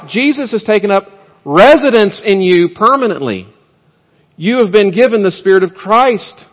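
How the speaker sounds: loud, high, fervent preaching; preaching at full force. Acted. Pastor urging the congregation, preaching at full force. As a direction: drive the gospel home, voice lifted high and loud.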